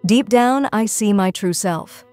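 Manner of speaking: ordinary talk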